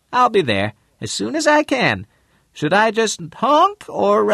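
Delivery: high bright female voice; woman's voice. Acted. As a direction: lift into a high lady's voice. Mrs Lane voiced high.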